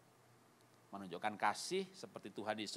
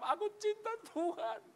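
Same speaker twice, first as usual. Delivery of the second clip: high, acted crying voice; tearful answer. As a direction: wail it as if moved to tears. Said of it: "Nangis dia, dia jawab". (jawaban sambil menangis terharu)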